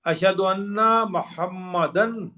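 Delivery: اشہد ان محمدًا said very slowly, with the "dan" ending clear; slow sounded-out recitation; slow demo. Shown as a demonstration. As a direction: stretch out محمدًا to show the ending. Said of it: "اب وہاں پہ محمدًا نہیں پڑھتے آپ".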